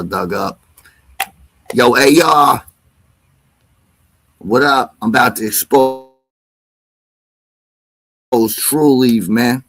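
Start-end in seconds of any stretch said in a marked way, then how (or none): 1.7-9.6 louder hype greeting to a friend; calling out loud. Real, not acted. Streamer hailing his friend A R, loud and hyped. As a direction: shout it across the room.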